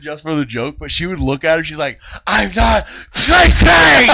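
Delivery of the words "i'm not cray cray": high, loud, drawn-out yell; hammed-up rage. Little girl glaring at her teaser, exaggerated screaming denial. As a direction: stretch it into a mock furious yell.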